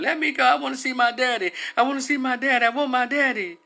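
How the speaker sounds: put-on high-pitched pleading wail of a little girl; imitated little girl's loud, high protest; yelling child. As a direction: yell like a distraught child demanding her father. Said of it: "She kept yelling".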